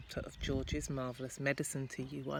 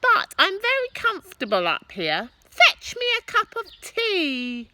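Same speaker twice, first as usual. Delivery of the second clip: bossy grandma; 11 dB louder than usual. Acted. Grandma ordering from roof.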